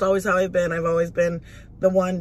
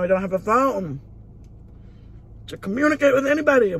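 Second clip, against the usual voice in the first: retold upset rising high and louder; angry retelling; 5 semitones higher, 3 dB louder than usual. Telling viewers about losing the phone, mildly angry and rising. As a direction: replay the frustration, climbing in pitch. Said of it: "I was so angry".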